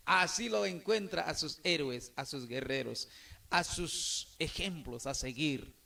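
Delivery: normal talking voice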